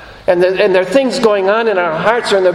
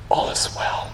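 whispered reassurance; 10 dB quieter than usual. Acted. Jesus whispering to congregant.

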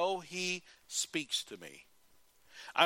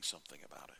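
whispered trail; 12 semitones lower, 14 dB quieter, much faster than usual. Real, not acted. Sentence ending.

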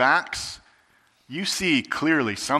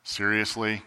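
low, slow, dismissive scoff; scoffing dismissal; 6 semitones lower, 4 dB quieter, slower than usual. Retold or imitated. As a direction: roll your eyes at the disciples, voice dropped. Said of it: "we look at it and we go".

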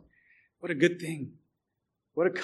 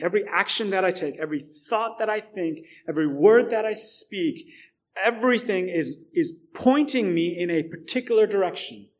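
rising, louder preaching build; preaching at full force; 4 semitones higher, 6 dB louder than usual. Acted. Pastor preaching to the church, building louder. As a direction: drive each line higher to the glory.

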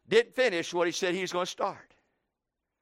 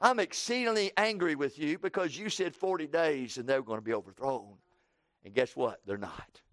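sulky complaint; 7 semitones lower, 7 dB quieter than usual. Acted. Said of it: "he says, "God".

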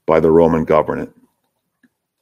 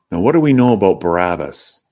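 ordinary delivery

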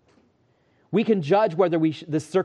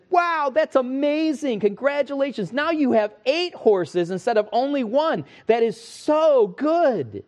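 high, slow gushing neighbors; excited neighbors; 9 semitones higher than usual. Acted. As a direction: gush over the good news like delighted villagers.

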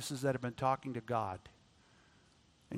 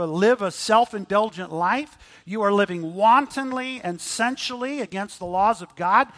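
loud, higher preaching, hammering each charge; preaching at full force; 5 semitones higher, 7 dB louder than usual. Acted. Preacher indicting the wealthy oppressors, loud and higher. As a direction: thunder the accusations at the rich.

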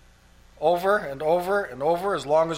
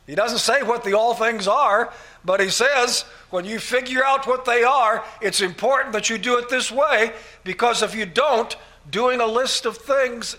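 heightened preaching; 6 semitones higher, 4 dB louder than usual. Real, not acted. Preacher rising to emphasis.

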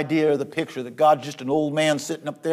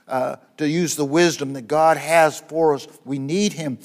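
usual speaking voice

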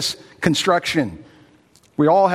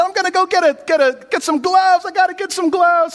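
much higher, rattled and hurried; flustered nurse; 13 semitones higher than usual. Retold or imitated. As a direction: sound like you can't bear to touch it. Horrified nurse rushing for gloves.

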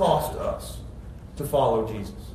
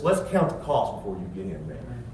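lower, soft and measured; calm Jesus; 9 semitones lower, 7 dB quieter than usual. Retold or imitated. Jesus asking the scribe to count the cost, calm and soft. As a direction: reason with him quietly. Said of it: "So Jesus says".

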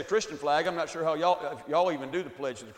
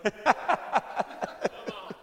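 airy, breathy chuckle; 6 semitones higher than usual. Real, not acted. Laughs at his own joke.

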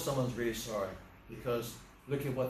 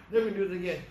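high, louder imitation of a pleading boy; mock tearful promise; 6 semitones higher, 3 dB louder, much faster than usual. Scolded boy promises the driver he'll stop, mock tearful. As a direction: act out a crying boy's insistent promise. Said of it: "He's crying".

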